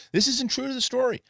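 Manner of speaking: high-pitched griping fan imitation; mock complaint. Reviewer mimicking fans upset about adaptations, high mock complaint. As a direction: pitch it up like a protesting purist.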